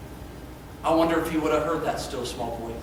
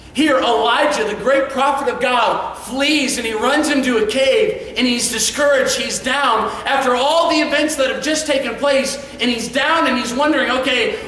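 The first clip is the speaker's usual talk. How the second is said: louder, higher preaching voice carrying the hall; full-force preaching; 6 semitones higher, 4 dB louder than usual. Acted. Preacher retelling Elijah's complaint to the church, full-force preaching. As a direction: preach it out with rising fire.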